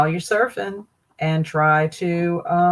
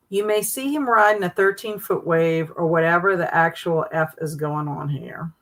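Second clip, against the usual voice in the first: ordinary talk, nothing marked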